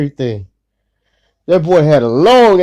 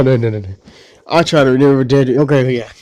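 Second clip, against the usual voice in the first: ordinary talk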